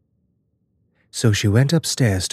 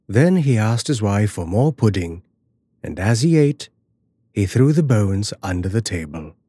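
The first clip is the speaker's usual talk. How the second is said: usual speaking voice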